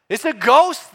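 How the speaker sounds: high, loud frightened cry; terrified disciples shouting across the water; cry of fear. Acted. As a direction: yell it like you've seen a ghost. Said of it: "they cried out in fear".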